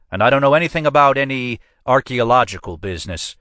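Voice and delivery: a police captain leaning on the visitors; captain's voice. A narrator's reading. Custer's held character voice.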